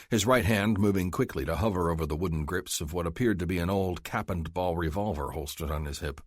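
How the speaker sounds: ordinary delivery, nothing marked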